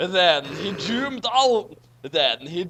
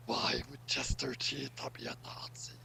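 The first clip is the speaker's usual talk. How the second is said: unvoiced low whisper; whispered musing; 6 semitones lower, 14 dB quieter than usual. Narrator voices the pondering villain, hushed whisper. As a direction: whisper the thought to yourself.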